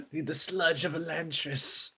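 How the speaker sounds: high, slow and breathy; frail breathless old person. Pretend. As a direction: moan it like a dying elder. Mocking dying infected prisoner.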